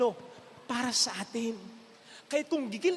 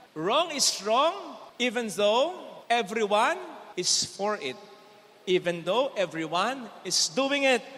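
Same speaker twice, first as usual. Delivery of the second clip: each word laid down slowly and firmly; slow emphatic moral; 3 semitones lower, slower than usual. Real, not acted. Priest to congregation, slow and firm. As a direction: drive the lesson home, slow and weighty.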